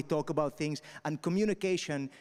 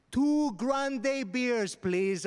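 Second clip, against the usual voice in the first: high, very slow, louder tourist voice; British tourist ordering; 9 semitones higher, 3 dB louder, much slower than usual. Retold or imitated. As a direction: overenunciate like a Brit abroad. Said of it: "two British people would always pop up and say".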